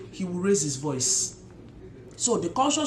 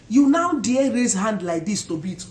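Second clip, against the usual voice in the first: usual speaking voice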